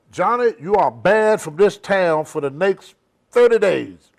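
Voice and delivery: hammed-up formal decree; mock official ban. Promoters banning Johnny from town, loud decree. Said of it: "So they said".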